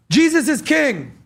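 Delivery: mock yell. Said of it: "You hear someone yell".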